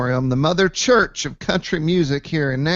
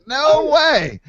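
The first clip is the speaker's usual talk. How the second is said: much higher, stunned; amazed no way; 12 semitones higher, 4 dB louder than usual. Retold or imitated. The driver hearing the name, amazed and high. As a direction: gasp it in disbelief.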